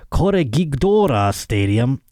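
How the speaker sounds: Spanish name with a Japanese-sounding accent; accidental Japanese-sounding Spanish. Real, not acted. Host butchering a Mexican stadium name, Japanese-sounding. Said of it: "I make Mexicans sound Japanese".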